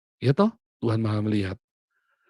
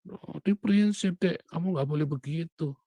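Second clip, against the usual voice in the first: put-on lecturing older sibling; 9 semitones higher, 4 dB louder than usual. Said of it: "Kakak saya ngaji".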